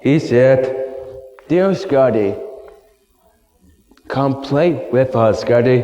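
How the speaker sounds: ordinary talk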